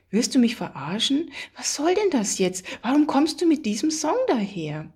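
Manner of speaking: high-pitched, agitated accusing questions; really annoyed. A narrator's reading. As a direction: fire off indignant questions, pitch climbing. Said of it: "wurde sie das erste Mal wirklich sauer". (richtig sauer, aufgebracht)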